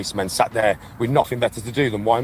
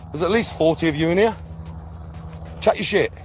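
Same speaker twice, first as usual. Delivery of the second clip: raised, goading dare; taunting; 8 semitones higher than usual. Real, not acted. Streamer daring the trolls in chat to type, angry taunt. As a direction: goad them, high and insistent.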